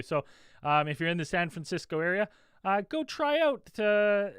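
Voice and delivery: usual speaking voice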